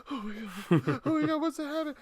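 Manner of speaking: high breathless gasping panic, laughing through it; terrified. Acted. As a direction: gasp then shriek like a horror victim. Acting Blair Witch horror victims.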